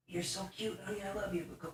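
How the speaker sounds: high, hushed pet talk. Real, not acted. Shooing the clingy cat off, high hushed coo. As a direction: coo at the cat while gently shooing her.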